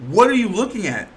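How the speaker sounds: loud, high-pitched indignant questioning; pissed off. Real, not acted. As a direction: demand an answer, really fed up. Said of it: "That's why I'm getting pissed".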